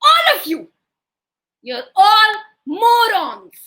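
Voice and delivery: loud, high, drawn-out telling-off; hammed-up scolding; scolding wife. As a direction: berate the whole crowd, stretching the words. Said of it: "came out and said".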